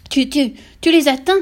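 stammering, high, loud yelp; cri glapissant. A narrator's reading. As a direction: splutter it out in shock. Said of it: "glapit la mère". (glapissement fort et bégayant)

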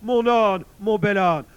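high, loud lament; wailing bear. A narrator's reading. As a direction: cry out for a beloved animal. Bear laments his donkey. (lamentation aiguë de l'ours)

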